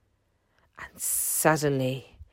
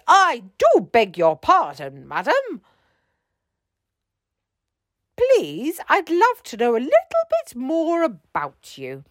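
a lightly raised courteous captain; apologetic captain; 7 semitones higher than usual. A narrator's reading. Captain apologises to the lady octopus, lightly courteous. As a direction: beg her pardon kindly.

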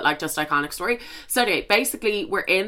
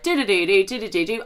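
sing-song phone jingle; imitated ringtone. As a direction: mimic a phone ringing in repeated little runs. Imitates her ringing phone.

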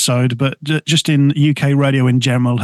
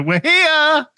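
much higher, cartoonish arrival cry; Aussies arriving; 13 semitones higher than usual. Host mocking Aussies landing in the UK, high cartoon voice. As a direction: squeak it like a triumphant gang landing. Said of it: "type thing".

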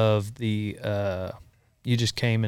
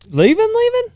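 surprised; 7 dB louder than usual. Real, not acted. Coworker announces he is leaving.

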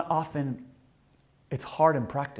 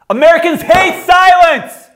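shout; 17 dB louder than usual. Acted. Shouting contrast after whisper.

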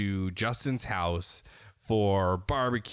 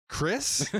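high, doubtful mock question; incredulous question; 9 semitones higher than usual. Wife questioning her husband about the text, incredulous. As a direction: ask it higher, in disbelief. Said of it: "And she's like".

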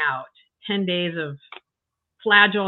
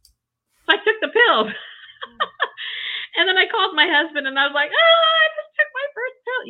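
high, loud, giddy laugh; laughing excitedly; 13 semitones higher, 4 dB louder than usual. Real, not acted. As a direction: laugh through it, thrilled. Excited laugh at taking the pill.

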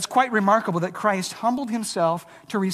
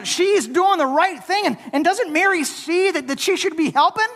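mock indignation; 6 semitones higher than usual. Voicing Martha's frustration.

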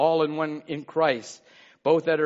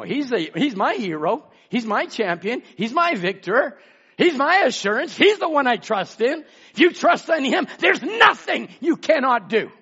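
preaching build; 4 semitones higher than usual. Real, not acted. Preacher praising Jesus to the church, building to a shout. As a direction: climb step by step to a full preacher's shout.

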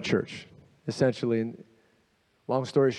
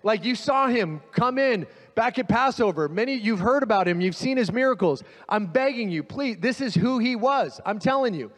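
urgent pleading, pitched higher; 7 semitones higher than usual. Retold or imitated. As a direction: beg the crowd earnestly, please listen. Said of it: "He's begging them".

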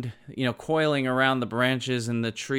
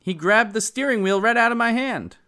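loud, high-pitched comic protest; Geraldine's comeback; 9 semitones higher, 6 dB louder than usual. Acted. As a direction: play an indignant wife defending herself, high and loud. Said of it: "And she came back".

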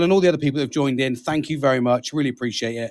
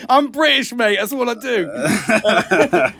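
much higher, giggling through the words; laughing joke; 8 semitones higher, much slower than usual. Real, not acted. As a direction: laugh it off cheekily. Jokes about offending Texas.

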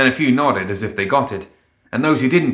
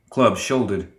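ordinary delivery

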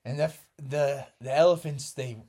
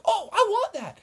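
eager want; 4 dB louder than usual. Acted. Factory workers want the shirt.

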